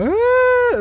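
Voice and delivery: a high, loud imitated crying wail; mock kids' wail. First graders crying back at the cheerful teacher, high loud wail.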